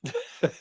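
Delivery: sudden high laugh; burst of laughter. Real, not acted. Laughs at unsafe car joke.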